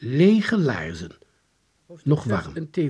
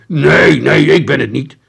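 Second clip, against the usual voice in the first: loud, protesting yell; full-voiced denial; shouted; 4 semitones higher, 14 dB louder than usual. A narrator's reading. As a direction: shout your innocence in panic. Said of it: "Van der Lorre schreeuwde".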